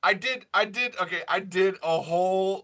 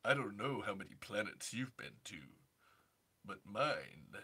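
George Takei impression; 10 semitones lower, 18 dB quieter than usual. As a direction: purr it low like a flirty Takei.

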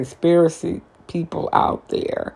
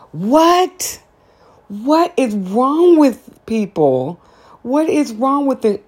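high, incredulous what-is-wrong-with-people; outraged disbelief; 8 semitones higher, slower than usual. Real, not acted. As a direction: squeal it out in shock. Outraged disbelief at borax bathing.

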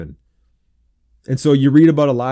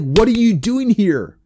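high, drawn-out exasperated question; incredulous questioning; 9 semitones higher, 4 dB louder, slower than usual. Retold or imitated. God answering the despairing prophet, incredulous and exasperated. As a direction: stretch the question in mock disbelief, pitched way up.

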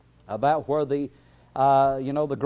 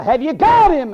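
high, loud devil's call; 11 semitones higher, 11 dB louder than usual. Acted. Devil checking with the underworld on the first day, calling out. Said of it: "I can imagine the devil calling".

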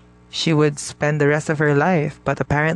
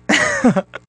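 sudden high-pitched laugh; high burst of laughter. Real, not acted. Laughs at own teasing "honey.

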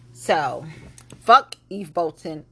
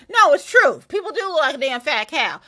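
higher and louder, shutting the critic down; loud retort; 6 semitones higher, 5 dB louder than usual. Real, not acted. Host fires back at people calling it body shaming.

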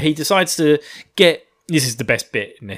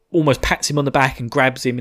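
ordinary talk, nothing marked

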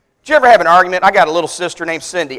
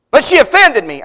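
mock whine of complaint, pitched up; indignant protest; 6 semitones higher, 4 dB louder than usual. Offended church member objecting to the pastor, indignant. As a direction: protest like someone wounded, higher voice.

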